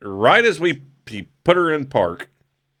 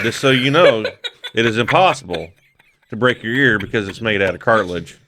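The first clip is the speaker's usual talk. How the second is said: ordinary talk, nothing marked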